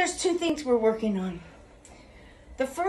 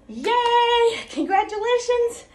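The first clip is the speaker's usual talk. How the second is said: much higher, louder cheering sign-off; excited cheer; 11 semitones higher, 5 dB louder than usual. Real, not acted. Coach cheering her class on day nine, excited. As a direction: cheer them on, bright and celebratory.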